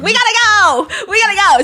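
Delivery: hammed-up newsroom scramble, calling out; excited reporters. News stations rushing to the scene.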